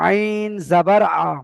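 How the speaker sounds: slow, syllable by syllable spelling; teacher spelling it out slowly for students; slow spelling demo. Shown as a demonstration. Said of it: "এই আটাকে আমার খেয়াল রাখতে হবে".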